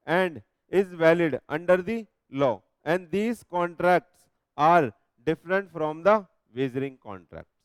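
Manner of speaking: very slow, word by word, so students can copy it down; slow dictation reading. Shown as a demonstration. Teacher dictating the act text to the class, slowly.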